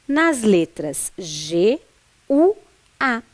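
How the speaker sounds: slow letter names "G, U, A" with pauses between; spelling out. Shown as a demonstration. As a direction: spell out G, U, A one by one. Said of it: "Acertou quem pensou". (soletrando pausadamente)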